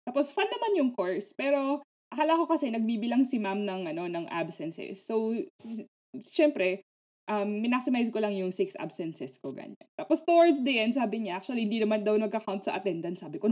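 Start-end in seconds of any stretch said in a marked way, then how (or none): none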